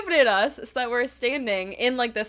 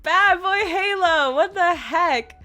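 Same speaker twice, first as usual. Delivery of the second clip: slow, higher retold telling-off; mock scolding; 4 semitones higher, 4 dB louder, slower than usual. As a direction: scold a friend by name, drawn out.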